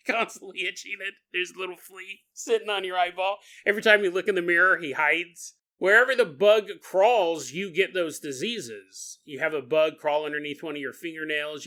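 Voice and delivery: ordinary talk, nothing marked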